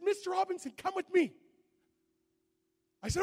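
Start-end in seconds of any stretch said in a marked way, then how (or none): 0.0-1.3 lightly voiced guide.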